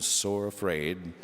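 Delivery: usual speaking voice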